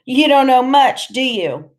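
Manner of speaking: mocking tough-guy put-down; scornful snort. A narrator's reading. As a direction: sneer it at someone clueless. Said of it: "Iggy snorts and he says".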